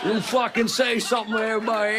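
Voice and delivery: high barked outburst at the crowd; mock angry outburst. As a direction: snap at the audience in fake rage. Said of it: "What if I did the rest of the show like that?".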